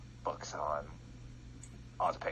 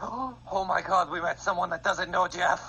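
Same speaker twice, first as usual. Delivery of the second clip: excited; 10 semitones higher, 7 dB louder than usual. Real, not acted. Amazed at stranger not knowing Jeff.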